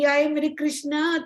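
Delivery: butter him up sweetly; honey-sweet. Acted. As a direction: Arjuna coaxing Krishna with honeyed, slow voice. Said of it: "हनी शहद के जैसे".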